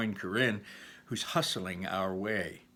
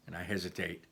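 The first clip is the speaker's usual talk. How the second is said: plain reading voice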